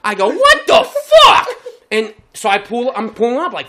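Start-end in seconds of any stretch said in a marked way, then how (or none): 0.3-1.5 very loud, high re-enacted shout; outraged yell. Driver yelling from his car window, outraged. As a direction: bellow it out the open window. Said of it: "that's exactly what I said".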